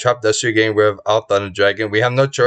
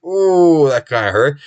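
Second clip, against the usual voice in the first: pained ooh; 3 semitones higher, slower than usual. Retold or imitated. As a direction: wince at the hit. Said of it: "which we're like".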